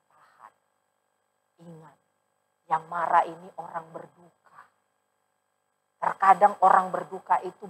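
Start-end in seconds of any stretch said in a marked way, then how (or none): 0.1-4.6 very soft, held-back caution; hushed warning. Retold or imitated. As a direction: quietly hold the elders back. Pastor restrains elders quietly. (pendeta berbisik menahan majelis)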